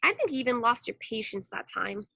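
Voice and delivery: usual speaking voice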